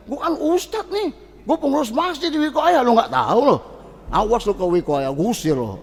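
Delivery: arrogant cleric. Retold or imitated. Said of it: "Kalau model begini".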